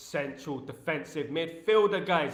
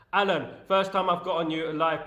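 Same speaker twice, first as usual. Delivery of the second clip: ordinary talk, nothing marked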